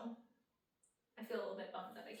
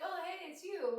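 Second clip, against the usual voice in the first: bright greeting, a bit higher; friendly greeting; 7 semitones higher, 3 dB louder than usual. Retold or imitated. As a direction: greet a fan like an old friend. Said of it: "Like, just like".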